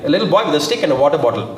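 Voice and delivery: normal talking voice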